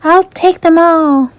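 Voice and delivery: buy everything with glee; delighted. A narrator's reading. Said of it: "Ariel told the sales clerk".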